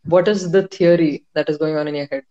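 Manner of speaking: ordinary delivery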